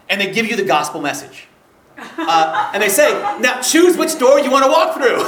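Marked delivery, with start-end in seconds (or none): 3.4-5.3 louder, higher, laughing through it. Retold or imitated. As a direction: deliver it cracking up. Actors giving the choice, laughing.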